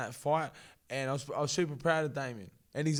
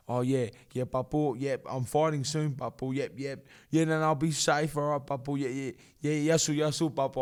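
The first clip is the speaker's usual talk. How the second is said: louder, eager phone chatter to grandpa; imitating Evan on phone; 7 dB louder than usual. Fighter on the phone to his grandfather before walking out, louder eager yeahs. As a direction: rattle off yeahs to reassure Papu. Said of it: "He's on the phone".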